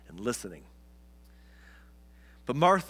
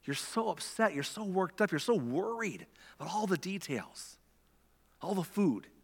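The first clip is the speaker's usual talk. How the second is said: higher and softer, gently reassuring; tender, soft Jesus; 5 semitones higher, 4 dB quieter than usual. Retold or imitated. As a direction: coax her kindly, soothing her worry. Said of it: "And so Jesus is like".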